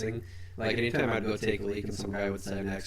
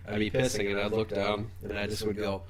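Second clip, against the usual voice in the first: ordinary delivery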